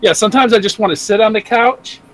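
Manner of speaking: ordinary talk, nothing marked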